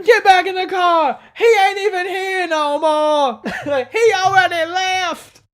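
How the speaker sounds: very high, loud mock scream, slow, laughing through it; exasperated yelling woman. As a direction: shriek it like a fed-up woman calling across a lot. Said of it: "And she says".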